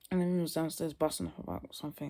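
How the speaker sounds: normal talking voice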